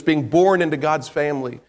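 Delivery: ordinary talk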